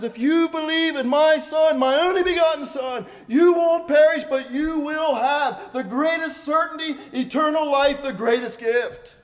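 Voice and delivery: booming voice of God, slowing at the end; fervent preaching. Retold or imitated. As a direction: proclaim it to the back pew. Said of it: "God says".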